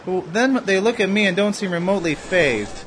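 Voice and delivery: ordinary talk, nothing marked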